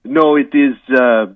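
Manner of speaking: hammed-up French accent, halting with uhs. Guest apologises to the host in a mock French accent. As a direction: play a comic Frenchman stumbling through English.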